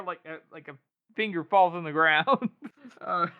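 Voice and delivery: ordinary talk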